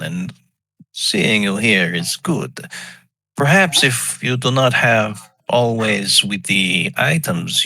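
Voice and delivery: slow, deliberate goblin tinkerer; measured, courteous in-character speech; goblin alchemist. Acted. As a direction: introduce yourself formally, word by word. Player voices goblin alchemist Hoffman.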